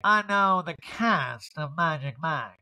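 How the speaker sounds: drawn-out put-on boasting; [name] bragging. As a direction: stretch every word boastfully. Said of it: "I love that [name] brags".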